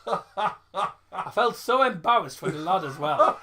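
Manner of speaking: laughing while telling it; giggling through the words. Real, not acted. Laughing at own story.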